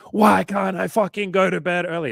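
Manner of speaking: high, loud mock exasperated yell; frustrated inner voice. Frustrated person berating themselves about bedtime, in a high loud mock yell. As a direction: wail at yourself for not sleeping. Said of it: "war going on of like".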